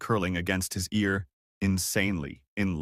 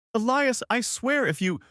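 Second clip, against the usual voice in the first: angry outburst; 13 semitones higher than usual. Acted. Furious groom protests.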